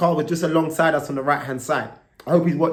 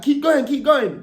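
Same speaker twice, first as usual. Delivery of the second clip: high, loud, slow urging call; urgent shout; 12 semitones higher, 5 dB louder, slower than usual. Retold or imitated. Friend in his ear yells at him to keep riding. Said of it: "He's like".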